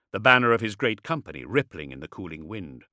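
ordinary delivery, nothing marked

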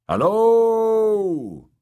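loud, high drawn-out holler; long high hello to whoever may be hiding; calling out. A narrator's reading. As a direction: call out into the empty ruins. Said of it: "called Nimble Dick".

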